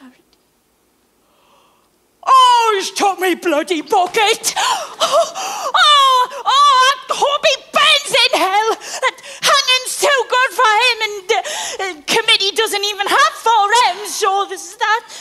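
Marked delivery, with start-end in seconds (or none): 2.3-14.1 half laughing, half crying outrage; outraged wailing. Acted. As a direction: wail in fury over the theft. Woman discovers stolen bucket.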